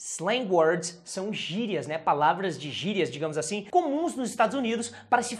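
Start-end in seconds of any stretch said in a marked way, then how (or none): none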